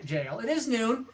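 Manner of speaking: ordinary delivery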